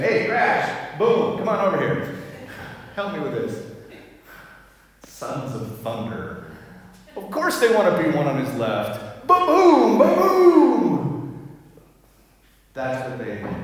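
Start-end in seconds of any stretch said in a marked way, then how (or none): 0.0-3.7 louder, higher buddy call with a laugh; jokey shout to the sons of thunder; playful Jesus calling. Retold or imitated. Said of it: "I can imagine him saying". 9.3-11.6 loud, high mock thunder booms; laughing ba-boom. Mimicking thunder brothers' swagger.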